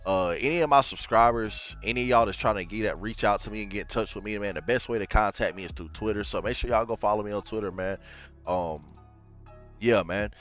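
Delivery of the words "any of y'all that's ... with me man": usual speaking voice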